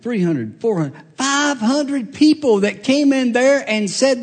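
high, loud preaching climax, slowing at the end; fiery preaching. Acted. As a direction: lift it up and preach it out. Preaching at full force.

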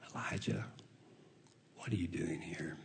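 voiceless whisper of God's question; preacher whispering God's question to the congregation. Acted. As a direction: breathe it as God's gentle whisper. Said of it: "And then there's a whisper".

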